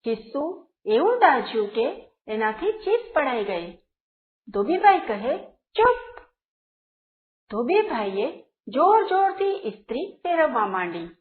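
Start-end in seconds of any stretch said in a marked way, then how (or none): 5.5-6.2 sharp hushing command. A narrator's reading. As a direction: silence them with one sharp high word. Laundryman silences the shrieking pocket.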